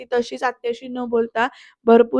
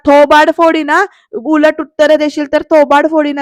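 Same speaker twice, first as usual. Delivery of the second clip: loud, acted threat; scolding yell; 2 semitones higher, 6 dB louder than usual. Bayo threatening Sarika for answering back, angry yelling. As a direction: bawl them out with raised voice. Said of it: "असं bio म्हणते बरं का ओरडून".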